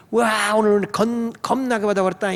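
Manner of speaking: Jeolla dialect quote with a long opening "wah" and a trailing "ing". Retold or imitated. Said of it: "처음 전라도 갔을 때".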